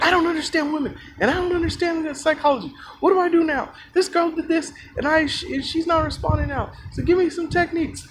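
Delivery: high-pitched, insistent whiny imitation; mock him in a high, droning complaint; mock obnoxious guy. Guy complaining about women across the table, high obnoxious mock voice. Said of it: "being hella loud and obnoxious".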